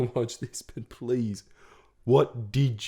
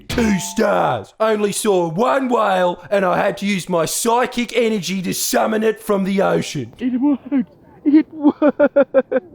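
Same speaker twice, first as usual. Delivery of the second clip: higher, louder hammed-up reviewer; mock excited review; 8 semitones higher, 5 dB louder than usual. Host giving her own walk a mock review, hammed-up excited. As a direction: announce it like a triumphant critic.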